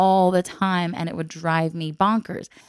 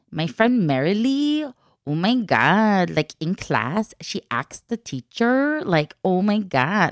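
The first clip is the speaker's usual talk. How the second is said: mock her accent with stretched vowels; mocking imitation of an affected accent; imitating Valley-style affected accent; slower than usual. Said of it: "Why are you talking this way".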